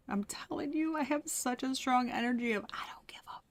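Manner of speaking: unvoiced confiding whisper. Real, not acted. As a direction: lean in and breathe it like a secret. Confiding a reading to viewers.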